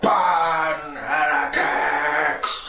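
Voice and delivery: lightly voiced, drawn-out menacing line; John Hurt power. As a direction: stretch it out with a hint of menace. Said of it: "with the power only John Hurt can bring".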